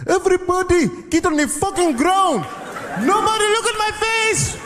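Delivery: barking orders at the passengers; hijacker shouting. Retold or imitated. Hijacker ordering the passengers down, high frantic shout. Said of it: "He said".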